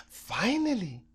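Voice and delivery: plain reading voice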